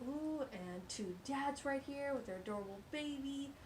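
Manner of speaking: ordinary delivery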